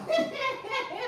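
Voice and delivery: short high laugh; high giggle. Real, not acted. Laughing at her own ASMR.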